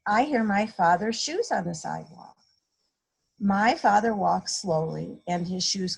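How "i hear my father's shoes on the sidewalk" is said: ordinary delivery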